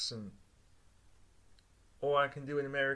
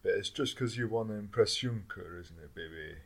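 seductive voice; 8 semitones lower than usual. Acted. Sultry voice playing Juncker's lover.

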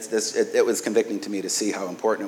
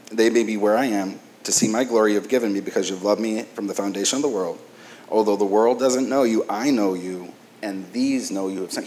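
louder, measured reading voice; scripture reading; 4 dB louder than usual. Acted. Pastor reading Jesus' prayer to congregation, formal scripture reading. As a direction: read the verse to the congregation.

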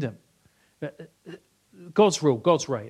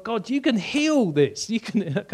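higher, slow earnest plea; crying out to God; 7 semitones higher, slower than usual. Retold or imitated. Waking with leg cramp, pleading to God, higher and slow. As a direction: beg God, slowly and higher. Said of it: "I cried out to God".

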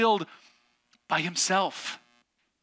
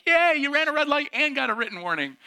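mock cheering mother-in-law; 10 semitones higher, 3 dB louder than usual. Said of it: "She's like".

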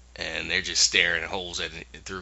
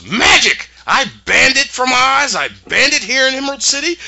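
much higher, louder hammed-up wizard tirade; mock shrieking ruler, faster at the end; outraged wizard; 8 dB louder than usual. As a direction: squeal it like a furious tyrant. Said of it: "And he goes".

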